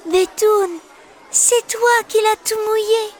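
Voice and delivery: put-on high small girl's voice; child telling who wet her drawing; Céleste reproaching. Céleste blames Bétoune.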